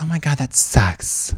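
low, breathed-out mock sympathy; acted aghast female friend, voice dropped; sympathizing girlfriend. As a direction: commiserate in hushed, drawn-out horror. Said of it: "Women, they're like".

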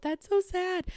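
high-pitched mock aww; pitying reaction. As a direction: coo with exaggerated sympathy. Reacting to the crying fan.

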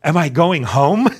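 hope bursting through the question; hopeful excitement. Retold or imitated. Defendant in the cage hoping to be released, eager. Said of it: "'Cause I'm so hopeful".

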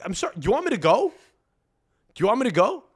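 high-pitched, indignant retold question; mock-offended retort. Helper scolded for doing it wrong, retorting indignantly.

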